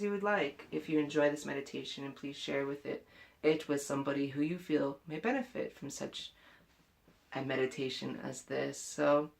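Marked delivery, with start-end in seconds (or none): none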